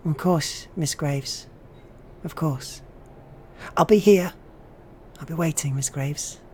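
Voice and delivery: put-on high, eerie child's voice; a ghost child eagerly promising to wait. Ghost child answers teacher.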